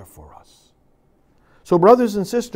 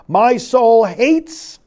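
slow heavy anger; 5 semitones higher, 5 dB louder, much slower than usual. Acted. Angry God quoted from Isaiah.

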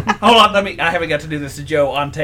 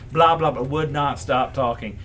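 ordinary talk, nothing marked

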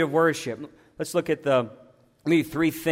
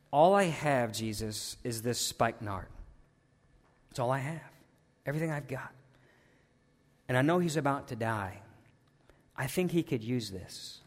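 much softer, hushed offering, nearly a whisper; quiet devotion; 6 semitones lower, 9 dB quieter than usual. Retold or imitated. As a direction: offer it gently under the breath, a plea of devotion. Mary offering her perfume to Jesus.

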